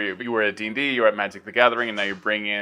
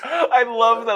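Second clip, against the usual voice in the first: laughing; 12 semitones higher, 4 dB louder, slower than usual. Real, not acted. Laughing at the turtle Hydra.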